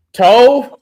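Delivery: loud rising call of the name. Real, not acted. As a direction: call across the room to get attention. Calling out to friend.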